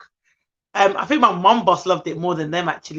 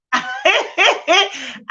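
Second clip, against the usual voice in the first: high-pitched burst of laughter; real, bubbly laugh at oneself; 9 semitones higher than usual. Real, not acted. Laughs at herself.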